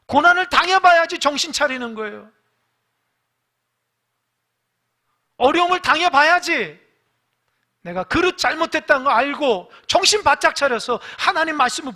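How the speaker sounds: high, loud, full-force sermon; fiery preaching. Acted. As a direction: preach it hard.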